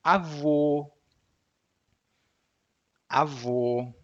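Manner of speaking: avô with a pure closed final ô, no glide; Portuguese avô. Shown as a demonstration. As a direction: say avô ending on a steady ô. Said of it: "One very common w-, word is".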